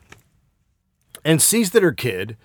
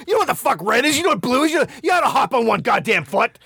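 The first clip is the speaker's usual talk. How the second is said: much higher, shouted mock rant; mock furious dad; 11 semitones higher than usual. Dad mock-berating his son at home, hammed-up shouting. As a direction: scold your kid in a hammed-up rage. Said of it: "I go".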